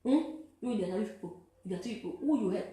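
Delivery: normal talking voice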